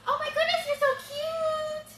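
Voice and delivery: very high-pitched gushing squeal; over-the-top cooing, sky-high pitch; excited baby talk. Shown as a demonstration. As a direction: gush loudly at the dog, stretching the last words. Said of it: "add excitement".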